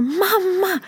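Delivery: put-on very high, glad little girl's call; child calling mamma. Mother imitating her daughter greeting her, high child voice. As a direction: call mamma the way a thrilled five-year-old would. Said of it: "säger hon så här".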